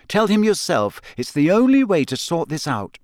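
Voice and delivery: brisk and firm; curt. Acted. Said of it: "Hermione said shortly".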